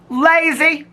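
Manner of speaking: high, loud teasing "Lazy" with a rising end; mock scolding. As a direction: jokingly scold the viewer as a slacker. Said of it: "I'ma yell at everybody".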